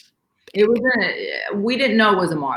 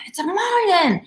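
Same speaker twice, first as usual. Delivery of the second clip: higher, thrilled announcement; excited shout-out; 11 semitones higher than usual. Retold or imitated. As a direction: call it out like you just spotted it. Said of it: "Very excited".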